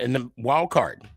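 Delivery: ordinary talk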